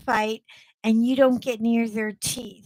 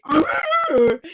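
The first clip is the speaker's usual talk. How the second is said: short startled growl-yelp; high-pitched mock dog yowl; 5 semitones higher, 4 dB louder than usual. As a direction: play the offended dog crying out. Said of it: "They're gonna be like".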